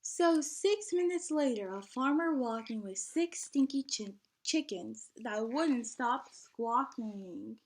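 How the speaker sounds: lively, sing-song storybook reading; bouncy picture-book narration; reading to children. A narrator's reading. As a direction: read the rhyme out to a class of kids.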